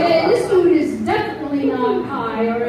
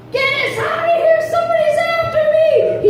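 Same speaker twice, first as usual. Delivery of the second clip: high frantic cry; mock panicked burglar yelling; 13 semitones higher than usual. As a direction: shout it like a fleeing burglar.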